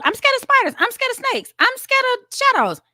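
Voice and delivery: mock frightened squeal. Confessing fears, squealing each one.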